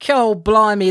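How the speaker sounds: hammed-up Aussie, loud and high; a clumsy fake Australian accent; mock Australian accent. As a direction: throw out a bad Australian accent for laughs. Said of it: "I'm just gonna get this out here and now".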